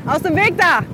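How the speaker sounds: calling out. Real, not acted. Cyclist breaks off chatting to clear the path, calling out. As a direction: call sharply ahead to clear the way.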